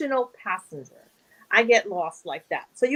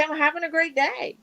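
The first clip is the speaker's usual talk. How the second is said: upbeat; 6 semitones higher than usual. Shown as a demonstration. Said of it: "just say".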